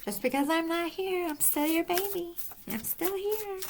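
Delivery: put-on child spirit's answer. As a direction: gently reassure as the little one.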